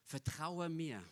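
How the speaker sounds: soft reassuring voice of Jesus; gentle Jesus. Pretend. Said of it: "Und Jesus sagt".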